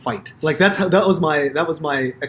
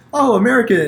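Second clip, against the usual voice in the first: high, loud, sneering "Oh, American"; much higher and louder mock scorn; mocking Parisian; 9 semitones higher, 8 dB louder than usual. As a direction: mimic a snooty local spotting a tourist. Parisian clocking him as American.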